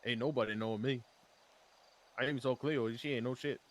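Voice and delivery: put-on a loose Cajun accent, lightly done. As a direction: play a swamp man with a Cajun accent. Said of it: "stop doing the accent".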